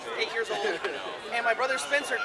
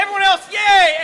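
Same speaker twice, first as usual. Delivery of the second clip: mock cheer; 7 semitones higher, 11 dB louder than usual. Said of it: "It's like".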